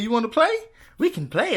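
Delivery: high, teasing mock challenge; playful taunting. Boyfriend playing along with the game, teasing high.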